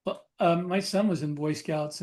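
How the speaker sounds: usual speaking voice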